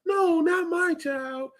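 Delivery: high, slow, far-off mock denial; mocking delusional parent. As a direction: play the clueless parent in a high, drawn-out voice. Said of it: "oblivious parents or delusional parents".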